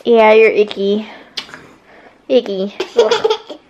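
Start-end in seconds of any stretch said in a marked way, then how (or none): 3.0-3.6 playful disgusted groan. Real, not acted. Mock disgust at icky child.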